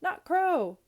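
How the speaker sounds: high, loud gleeful yell; drawn-out high holler. A narrator's reading. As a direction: shout it out across a meadow. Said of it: "I yelled".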